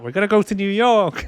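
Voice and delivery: pitched well up, dazed and cheerful; high dazed quote. Retold or imitated. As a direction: say it high, like a dead man who hasn't noticed. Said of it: "he's sat at the end like".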